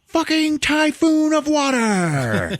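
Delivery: building to a loud, high drawn-out cry; dramatic exclamation. Retold or imitated. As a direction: blow the line up into a slow, epic yell.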